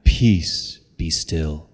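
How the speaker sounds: low, hushed parody of a gentle Jesus; mock-serene Sunday-school Jesus; serene picture-book Jesus. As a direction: intone it low and calm, falling away.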